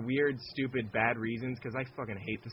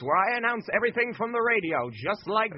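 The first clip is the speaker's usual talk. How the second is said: put-on higher, louder old radio announcer; 1940s radio announcer; 6 semitones higher, 6 dB louder than usual. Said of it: "This is my nineteen forties voice".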